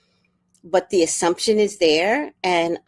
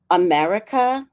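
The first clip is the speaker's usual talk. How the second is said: normal talking voice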